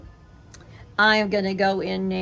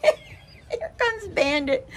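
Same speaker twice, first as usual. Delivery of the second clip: words breaking up with high laughter; real giggle while telling the story; laughing; slower than usual. Real, not acted. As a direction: laugh through it, squeaking high. Laughing at the dogs running in.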